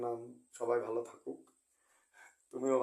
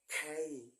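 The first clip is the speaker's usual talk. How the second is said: American-accent খেই, smoother; খেই in an American accent demo; 2 semitones higher, much slower than usual. Shown as a demonstration. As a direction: give খেই an American flavour. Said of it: "American উচ্চারণ আরো smart".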